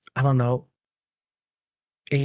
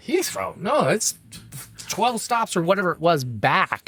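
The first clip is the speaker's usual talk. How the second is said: incredulous conductor; 7 semitones higher than usual. Retold or imitated. Conductor incredulous at drunk passenger.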